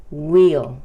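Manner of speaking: "will" as a loud "weel"; pronounced "we'll". Shown as a demonstration. Teacher naming the contraction, emphatic "will" said "weel". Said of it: "Se pronuncia".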